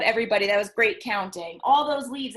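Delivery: plain reading voice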